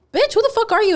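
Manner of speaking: mock outrage. Quitting worker snapping at her bossy manager.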